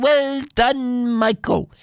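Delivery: high, hearty praise; cheering villagers. Acted. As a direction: cheer him on like a crowd.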